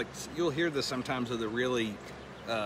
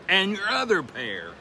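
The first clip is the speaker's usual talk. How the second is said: higher, slow and louder; pointed follow-up; 7 semitones higher, 7 dB louder, slower than usual. Retold or imitated. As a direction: press it, slower and higher. Repeated, more pointed.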